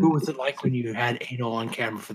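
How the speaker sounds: soft flat deadpan question; monotone voice. Retold or imitated. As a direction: ask it in a dead monotone. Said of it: "a real monotone voice like this".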